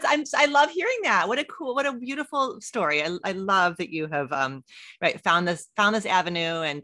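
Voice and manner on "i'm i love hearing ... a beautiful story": high, loud laughing delight. Real, not acted. To the guest after her story, laughing delight. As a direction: laugh warmly, thrilled.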